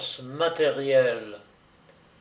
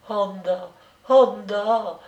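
crying out; 6 semitones higher, 4 dB louder, slower than usual. Acted. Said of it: "cria la jeune femme".

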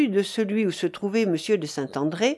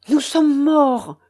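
higher, louder, slow cry of doom; alarmed cry; 6 semitones higher, 6 dB louder, much slower than usual. A narrator's reading. Frightened youth warning the commander, alarmed. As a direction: announce disaster, drawing out the words. Terrified narrator reports the plot.

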